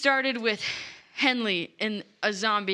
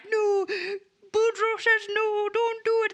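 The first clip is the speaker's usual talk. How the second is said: hammed-up high plea; 10 semitones higher than usual. Vines plead with Lila.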